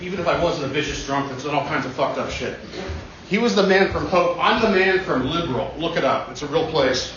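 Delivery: ordinary talk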